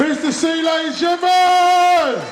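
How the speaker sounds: high, loud, drawn-out ladies and gentlemen; host's announcement call. Real, not acted. Host calling the room to cheer the act, loud and high. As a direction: stretch it out like an MC calling the crowd.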